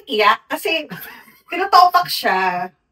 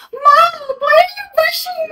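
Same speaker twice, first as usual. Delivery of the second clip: high, loud mock-whine of a toddler; whiny kid voice; 5 dB louder than usual. Son complaining to mom about being pushed, high whiny shout.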